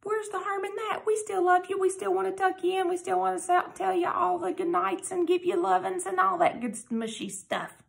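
high, fond gushing voice; gushing parent. Acted. Reader to listeners, gushing about tucking kids in, high and fond. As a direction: coo it like a doting parent.

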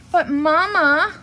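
high, loud drawn-out whine; whining. A narrator's reading. Sister bear whining to Mama at the checkout, whiny. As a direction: whine like a pleading little sister. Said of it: "whined Sister".

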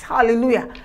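usual speaking voice

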